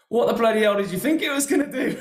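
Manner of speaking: high, incredulous mock scolding; amused retold exasperation. Owner berates new starter with the hammer, laughing mock outrage.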